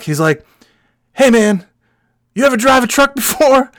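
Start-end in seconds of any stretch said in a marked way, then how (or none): 1.1-3.7 higher-pitched take on a trucker; imitated trucker. Trucker asking his passenger to drive, imitated trucker.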